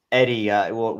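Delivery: usual speaking voice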